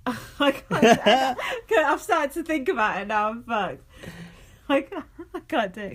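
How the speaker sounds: real laughter breaking into the words; giggling, giving up. Real, not acted. Podcaster failing at a French accent for co-host, giggling helplessly. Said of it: "I'm gonna struggle with the French accent".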